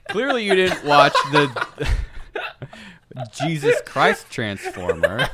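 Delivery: high, loud and drawn out through laughter; real laughter breaking into slow, high words; laughing mock outrage. Real, not acted. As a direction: barely get it out for laughing. Cracking up over a Transformers joke.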